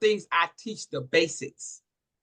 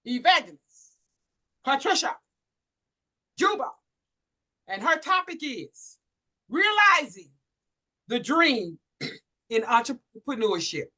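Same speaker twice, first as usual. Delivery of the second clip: announcer introduction; 3 semitones higher than usual. Real, not acted. Announcing speaker name.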